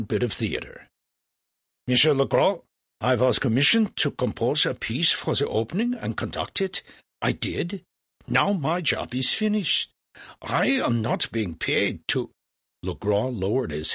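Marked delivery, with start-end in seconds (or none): 1.9-12.3 put-on German-accented young composer, slightly raised pitch; protesting Mozart. Mozart refuses Legras's order, theatrically stubborn. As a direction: object with staged indignation.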